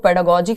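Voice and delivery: पेडागोजी with a soft g, like English j; soft G variant. Shown as a demonstration. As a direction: say पेडागोजी ending in "jee". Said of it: "कह देते हैं कई लोग".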